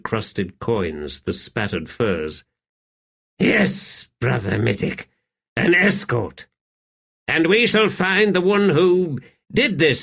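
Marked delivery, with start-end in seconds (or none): 3.4-10.0 put-on commanding young emperor. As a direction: bark out orders to the warlock king. Rulad voiced.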